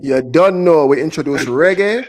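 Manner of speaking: loud Jamaican patois voice; big it up in a Jamaican accent. Retold or imitated. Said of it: "talking in the Jamaican accent".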